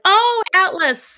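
drawn-out, high "oh" of realisation; mock realisation. Neighbours' mock realisation about dog name.

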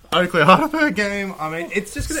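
cracking up into a squeak. Real, not acted. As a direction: voice breaking high with excitement. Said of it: "So good that your voice just broke".

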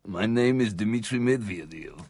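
deep, soft mock-Russian voice; low, quiet comic Russian; Russian accent. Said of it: "put on the Russian accent".

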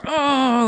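high "oh" of revulsion; imitated disgusted groan. Crew looking at dog mess, disgusted groan. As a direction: groan like a grossed-out crowd. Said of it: "everyone goes".

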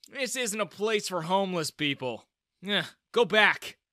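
looming, dismissive guard shooing her off; sneering guard. Acted. Security guard sneers at shabby girl, contemptuous. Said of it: "he sneered".

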